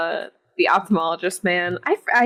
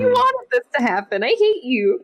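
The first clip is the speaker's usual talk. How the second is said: mock angry retort; 12 semitones higher than usual. Fan mocking the husband, a half-hearted outraged high cry. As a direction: pitch jumps high in a mock outburst, What? You wanted this. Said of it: "it's like".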